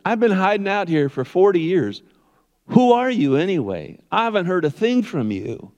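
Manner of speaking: high-pitched complaint; whining Moses. Acted. Preacher playing Moses complaining to God, high-pitched whining. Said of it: "he's whining and he's complaining".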